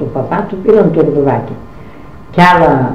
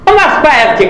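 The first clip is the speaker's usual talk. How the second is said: much higher mocking scoff; scornful retort; 11 semitones higher, much faster than usual. Mother-in-law scoffing at daughter-in-law going, high mock scoff. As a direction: sneer at the idea.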